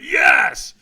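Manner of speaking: high, all-out shouted reply; retold scream, loud and high; screamed yes. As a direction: yell it back as loud as you can. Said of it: "I screamed yes as loud as I could".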